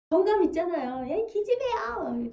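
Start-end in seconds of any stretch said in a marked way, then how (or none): 1.1-2.3 affectionate teasing friend. Retold or imitated. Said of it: "막 이러면서".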